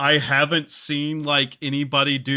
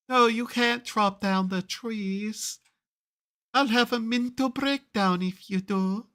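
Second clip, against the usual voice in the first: femboy elf voice; 9 semitones higher than usual. Acted. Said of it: "I gave elves that accent".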